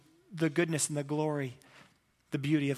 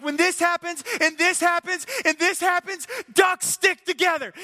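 much higher, loud, slowing shout at the end; shouted rally cry; 13 semitones higher, 6 dB louder than usual. Retold or imitated. Locker room rally at its peak, shouted rally cry. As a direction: yell it like the peak of the pep talk.

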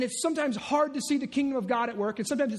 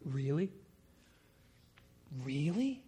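low, softer drawn-out really; sarcastic really; 8 semitones lower, 7 dB quieter than usual. Acted. Preacher showing Satan's sarcasm, low and soft. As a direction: scoff the word Really with doubt. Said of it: "I can just hear the sarcasm".

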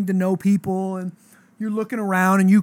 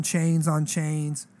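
slow, stretched "chains on chains"; 4 dB quieter, much slower than usual. Retold or imitated. Describing the guy's chains.

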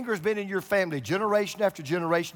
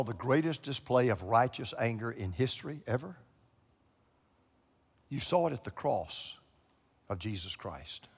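soft, lower, intimate preaching; hushed sermon close; 3 semitones lower, 5 dB quieter than usual. Acted. Pastor closing the sermon to the congregation, hushed and earnest. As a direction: draw them in quietly to the close.

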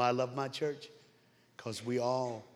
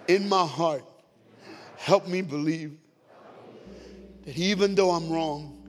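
led prayer; 5 semitones higher, 4 dB louder than usual. Real, not acted. Preacher leading the congregation through a salvation prayer, projected and deliberate. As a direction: pray it out loud for the room to follow.

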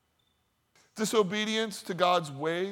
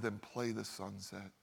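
gentle hush; 7 semitones lower, 12 dB quieter than usual. Retold or imitated. Teacher gives his gentle advice.

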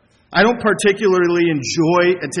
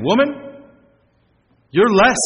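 louder, higher mock put-down; mock pejorative; 5 semitones higher, 3 dB louder than usual. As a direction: belittle her, as an example of disrespect. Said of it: "He wasn't meaning it as a pejorative".

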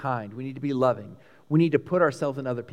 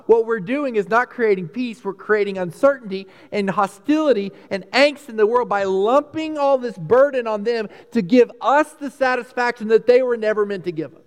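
ordinary talk

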